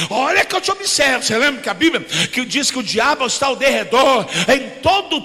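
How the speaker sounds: loud, rhythmic sermon shout; full-force preaching. Acted. Pastor preaching loudly on being a true man of God. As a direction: preach it hard to the congregation.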